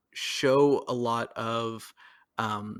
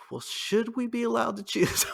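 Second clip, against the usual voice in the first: much higher, put-on questioning voice; mock doubting question; 10 semitones higher than usual. Reacting to meeting idiots, high mock doubt. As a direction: pipe it up in pretend hesitation.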